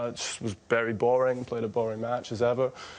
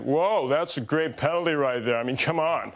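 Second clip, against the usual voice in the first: impersonating John McEnroe; 2 semitones higher than usual. Retold or imitated. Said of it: "he was like".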